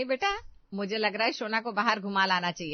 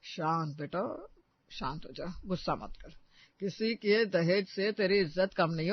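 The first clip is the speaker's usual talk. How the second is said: calming; 2 semitones lower, 4 dB quieter than usual. Acted. Father-in-law soothing his angry daughter-in-law, soft and calm. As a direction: gently settle her down.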